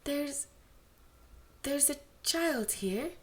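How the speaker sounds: nervously stumbling over the warning; hesitant. Acted. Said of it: "a hesitant voice spoke up".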